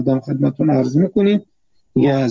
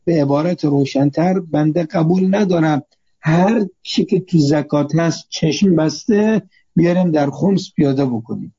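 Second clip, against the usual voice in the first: normal talking voice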